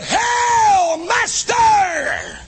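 Judas's greeting. Acted. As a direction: hail him with false cheer. Judas greets Jesus, quoted loudly.

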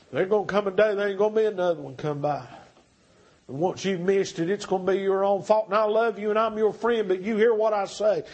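usual speaking voice

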